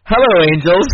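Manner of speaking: mock cheery boss voice; hammed-up greeting. Quoting a show's opening line, hammed-up greeting. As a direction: sing out the greeting like a TV opener. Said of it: "and it goes".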